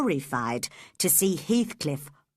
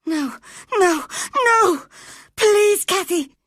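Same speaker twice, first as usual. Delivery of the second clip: high, loud, crying scream of terror; terrified boy screaming at Cathy not to leave; wild scream; 13 semitones higher, 9 dB louder than usual. A narrator's reading. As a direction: scream in panic, clinging on. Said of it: "screamed Linton wildly".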